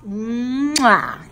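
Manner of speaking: big loud mwah; exaggerated kissing noise; kiss sound. Shown as a demonstration. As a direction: smack a kiss for a child. Acting out the word "kiss.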